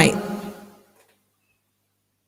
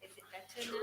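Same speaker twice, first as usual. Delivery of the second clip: very faint, far-off voice; faint voice on a weak connection; barely audible; 30 dB quieter, faster than usual. Real, not acted. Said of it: "It has to be much louder".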